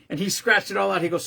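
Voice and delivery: ordinary talk, nothing marked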